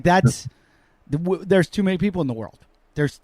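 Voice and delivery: ordinary delivery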